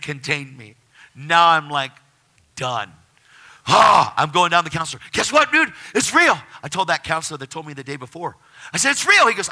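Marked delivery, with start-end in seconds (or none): 3.7-4.1 loud, high excited "ah"; excited yell. Retold or imitated. New believer rushing to his counselor, excited yell. Retold ecstatic freak-out. 5.1-6.4 high, loud excited news. Retold or imitated. Telling the counselor it is real, so excited. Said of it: "I was just so excited". 8.9-9.3 very high, loud insistence; so excited. Retold or imitated. Telling the counselor it is real, so excited. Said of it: "I was just so excited".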